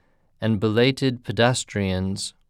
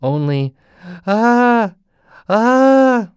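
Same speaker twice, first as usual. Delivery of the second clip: terrified gasps; 12 semitones higher, 8 dB louder, much slower than usual. Acted.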